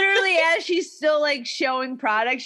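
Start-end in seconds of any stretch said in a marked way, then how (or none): none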